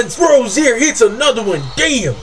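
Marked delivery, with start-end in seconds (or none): none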